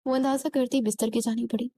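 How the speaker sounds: plain reading voice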